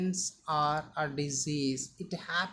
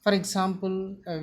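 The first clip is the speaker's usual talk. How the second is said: ordinary talk, nothing marked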